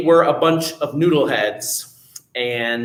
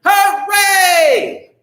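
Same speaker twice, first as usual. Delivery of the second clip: high, loud cheer of hooray; shouted hooray; 5 dB louder than usual. Pretend. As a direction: cheer it out to the kids. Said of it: "shout hooray".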